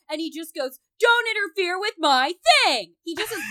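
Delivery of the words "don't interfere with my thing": high, loud mock-furious shout; hammed-up angry yell; James Remar yelling. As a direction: bellow it like an actor losing his temper. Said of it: "he's just yelling at them".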